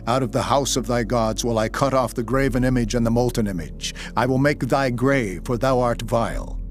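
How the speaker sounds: usual speaking voice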